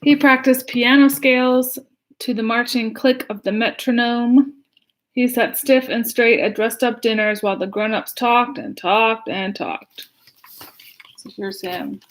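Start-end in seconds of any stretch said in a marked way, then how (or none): 0.0-8.8 put-on lively read-aloud to children; story reading. Reads picture book aloud. 8.8-10.1 slowed down. Acted. Drawn out for endless talk.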